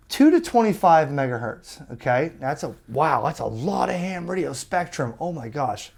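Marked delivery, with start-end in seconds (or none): none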